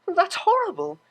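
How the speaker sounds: high, gasping disbelief; shocked Octavia. A narrator's reading. Octavia hearing Vinyl's story, high and shocked. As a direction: voice climbs in shock.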